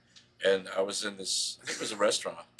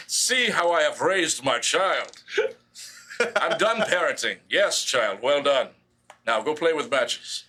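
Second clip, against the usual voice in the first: loud, higher, slow grandiose decree; dark lord; 5 semitones higher, 6 dB louder, slower than usual. Pretend. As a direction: proclaim it like a pompous overlord. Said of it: "I was like".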